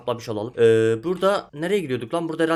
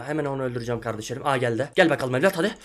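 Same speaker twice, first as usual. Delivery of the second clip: ordinary delivery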